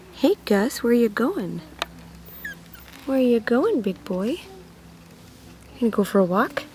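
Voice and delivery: ordinary delivery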